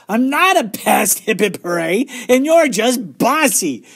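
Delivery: high, loud, stretched-out protest; angry shout. A narrator's reading. Little sister accuses older one of bossiness, angry shout. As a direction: shout it slowly at a bossy sister. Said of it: "she shouted".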